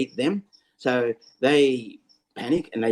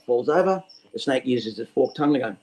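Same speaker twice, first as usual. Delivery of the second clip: usual speaking voice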